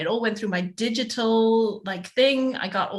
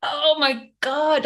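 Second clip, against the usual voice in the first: exasperated; 5 semitones higher, much slower than usual. Retold or imitated. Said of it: "And I was like".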